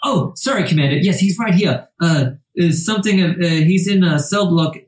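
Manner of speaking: high, flustered stammering clerk; a nervous clerk caught out by his boss. Acted. Nervous clerk answers the commander, stammering and high.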